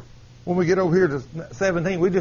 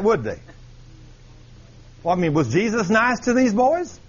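normal talking voice

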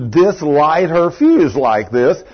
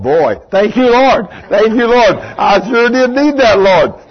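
higher and louder grateful prayer; relieved thanks; 7 semitones higher, 4 dB louder than usual. Retold or imitated.

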